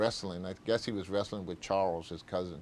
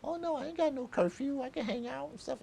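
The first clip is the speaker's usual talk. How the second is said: put-on much higher, girlish voice for the young woman; girlfriend's voice; 4 dB quieter than usual. Teller quoting his future girlfriend, high girlish voice. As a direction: put on her high bright voice. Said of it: "she goes like".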